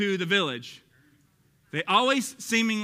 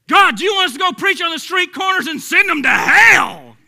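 put-on loud, high, excited Texas-accent shout; 11 semitones higher, 14 dB louder than usual. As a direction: holler it in a fired-up Texas accent. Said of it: "I just give it a little Texas accent".

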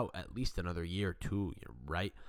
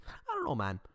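normal talking voice

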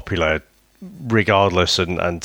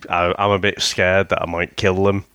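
ordinary delivery

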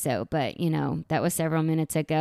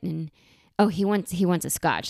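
usual speaking voice

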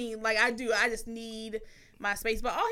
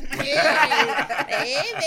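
louder, laughing, sweet; laughing sweet greeting; 4 semitones higher, 8 dB louder, much slower than usual. Retold or imitated. Greeting partner who brought snacks, laughing and sweet. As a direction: greet him through a laugh. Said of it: "I just".

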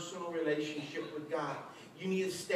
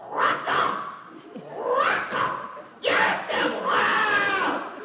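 full-throated, loud screamed challenge; all-out shouting, building to a yell; battle cry; 13 semitones higher, 9 dB louder, much slower than usual. Acted. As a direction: scream defiance at the devil. Acting faith rising against devil.

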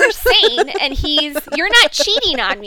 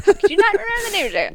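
rapid, higher mock scolding; 7 semitones higher, much faster than usual. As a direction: rattle off the scolding fast and high. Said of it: "I get yelled at".

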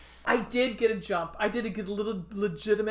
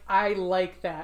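ordinary delivery

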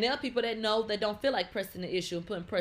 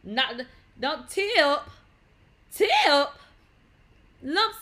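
hyped shout; 6 semitones higher, 8 dB louder, much slower than usual. Acted. Mocking tipping, hyped up.

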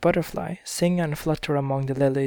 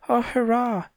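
high, happy cry; cried out; 9 semitones higher than usual. A narrator's reading. Lionel cheering after the dragon is trapped, high excited cry. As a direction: cry out with joy. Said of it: "he cried".

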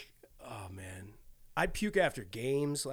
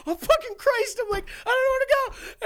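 high, strained mock panic; retold panic; 3 dB louder, faster than usual. Player about to puke on the bench, high panicked whine.

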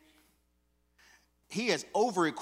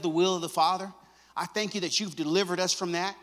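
high, loud preaching prayer; shouted thanksgiving prayer to the Father; loud closing prayer; 5 semitones higher, 6 dB louder than usual. Acted. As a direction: pray it out over the church. Closing prayer at full force.

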